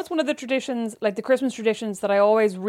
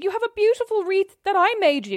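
mock offended; 9 semitones higher than usual. Real, not acted. Sister indignant about her wreath.

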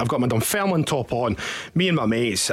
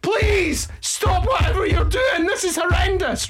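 frantic banging shouts. Retold or imitated. People banging on the cubicle door shout at the man inside, high and frantic. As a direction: yell through the door, half laughing in disgust. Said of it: "people were banging on the cubicle going".

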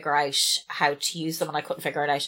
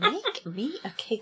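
playful demand; 4 semitones higher, 5 dB quieter, much slower than usual. Real, not acted. Repeating the cake demand.

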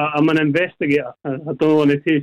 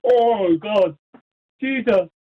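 stunned disbelief; 5 semitones higher, slower than usual. Real, not acted. Winner hears of fifty grand.

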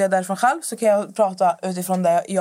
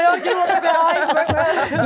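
put-on much higher little boy voice through laughter; imitating the son, laughing and higher; laughing son quote; 8 semitones higher, 4 dB louder than usual. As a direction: giggle out the son's logic. Son's answer quoted, laughing.

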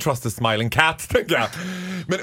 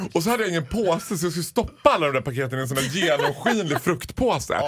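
normal talking voice

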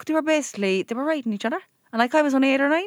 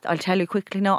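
ordinary delivery

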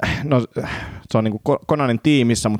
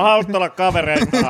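mock customer; 8 semitones higher than usual.

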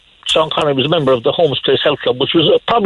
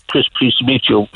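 lower, deep Scottish greeting; Scottish accent, pitched down low; 7 semitones lower than usual. Retold or imitated. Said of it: "with that Scottish deep voice".

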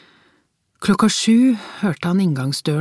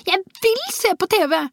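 put-on very high-pitched little girl's voice; protesting child. A narrator's reading. Small girl protests in father's arms, high and defiant. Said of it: "protesterte hun".

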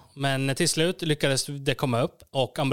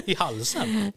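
higher, slow laughing question; laughing disbelief; 6 semitones higher, much slower than usual. Real, not acted. (skrattande, högre och långsam fråga)

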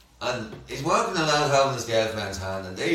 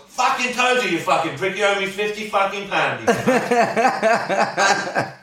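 put-on higher, louder gloating London accent; triumphant EastEnders London bloke; 6 semitones higher, 3 dB louder, slower than usual. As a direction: crow at his mates in a London accent. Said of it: "that caught the accent".

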